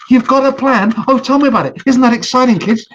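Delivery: put-on thrilled and asking the kids along; excited turtle.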